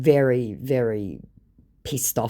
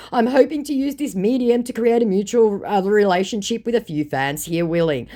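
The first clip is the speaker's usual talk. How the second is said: scammer voice; 8 semitones higher, 6 dB louder than usual. Retold or imitated. Voicing scammer's messages.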